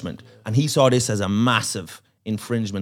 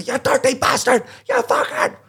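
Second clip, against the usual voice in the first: high, rising hammed-up rage; mock frenzied snarl; 13 semitones higher than usual. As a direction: lash out like a cornered madman. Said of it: "he just turns around".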